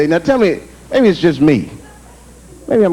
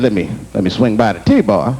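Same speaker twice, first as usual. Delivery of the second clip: lower, offhand aside; lowered nonchalant line; casual self; 10 semitones lower than usual. Retold or imitated. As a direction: toss it off casually.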